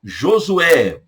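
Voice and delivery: normal talking voice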